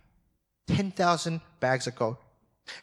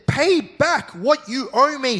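high, louder and slow demand; 11 semitones higher, 6 dB louder, slower than usual. A narrator's reading. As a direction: press him hard for the money. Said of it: "he demanded".

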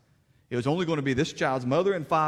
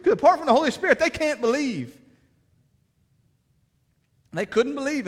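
preaching with rising force, louder and higher; fervent preaching; 12 semitones higher, 7 dB louder than usual. Acted. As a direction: drive it home like a revival preacher.

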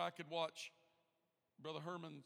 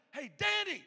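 high, loud call by name; 11 semitones higher, 11 dB louder than usual. Acted. The Lord calling someone who tunes him out, hollering. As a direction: holler to get someone's attention. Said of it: "How many times does the Lord say".

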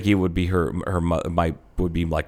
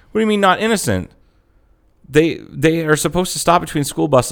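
indignant daughter; 7 semitones higher than usual. Retold or imitated. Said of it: "And she's like".

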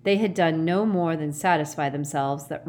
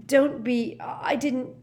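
higher, halting protest; awkward mutter; 8 semitones higher, slower than usual. A narrator's reading. Harry embarrassed by thanks, awkward broken protest. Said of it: "muttered Harry awkwardly".

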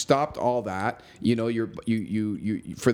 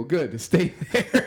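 laughing through the words, higher pitch; 7 semitones higher, slower than usual. Real, not acted. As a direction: let the laugh break into the line. Laughing while retelling his text.

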